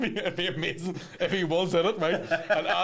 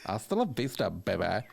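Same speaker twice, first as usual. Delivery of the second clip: sassy Terminator; 8 semitones lower, 4 dB quieter than usual. Pretend. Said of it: "she's doing a bit of sass on it".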